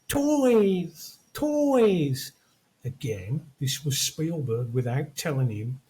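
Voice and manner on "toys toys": put-on high, drawn-out child's call sliding down; excited little kid. The kid in the film calls at the window, high excited child voice. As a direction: call it out like a delighted small boy. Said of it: "he's going".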